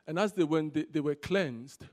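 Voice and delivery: loud, measured reading of the Bible; scripture reading. Acted. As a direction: proclaim the verses to the congregation. Pastor reads scripture aloud.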